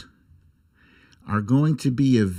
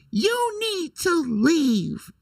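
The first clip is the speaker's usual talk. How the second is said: high, slow, drawn-out "You need to leave"; catchphrase clip voice; slower than usual. Retold or imitated. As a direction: stretch it out up high like the famous clip. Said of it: "my little sound clip".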